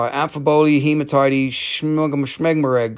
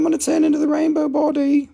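very high comic voice; voiced meditating Buddha; 13 semitones higher than usual. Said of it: "He's like".

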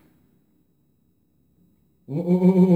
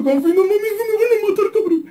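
much higher, strained near-scream laugh; panicked laughing cry; 4 dB louder than usual. Real, not acted. Panic mid fight. (chillido de pánico entre risas)

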